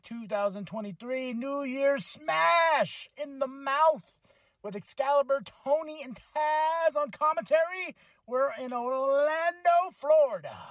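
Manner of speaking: high-pitched podcast hype opener with stretched-out names; hyped announcer intro. Acted. Host welcomes listeners to the New World Podcast, hyped announcer.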